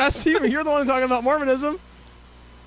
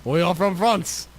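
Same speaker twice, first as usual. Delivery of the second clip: lower, put-on French accent; bad French accent; 6 semitones lower than usual. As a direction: declare it in mock French. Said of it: "That was my French accent".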